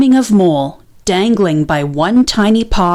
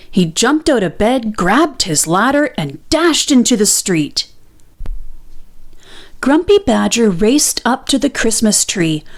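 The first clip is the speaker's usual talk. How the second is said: ordinary delivery, nothing marked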